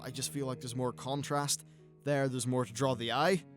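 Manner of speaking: normal talking voice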